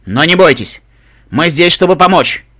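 loud, regal announcement; Royal Canterlot voice. Acted. Ghoul reassures the ponies, in booming royal voice. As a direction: proclaim the reassurance at full volume. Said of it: "мы всегда говорим королевским кантерлотским голосом".

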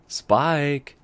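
loud call across the apartment. Acted. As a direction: call his name from the doorway. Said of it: "Twilight called".

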